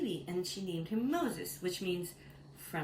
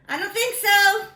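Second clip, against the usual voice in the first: high, loud mock-defiant retort; loud refusal; 12 semitones higher, 12 dB louder than usual. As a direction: belt out a sassy no. Pharaoh refuses Moses.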